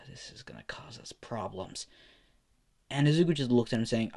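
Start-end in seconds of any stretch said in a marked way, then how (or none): 0.1-1.9 whispered, much softer; worried whisper. Acted. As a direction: mutter the worry under your breath. Bakugo worried aside.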